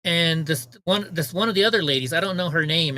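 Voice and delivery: normal talking voice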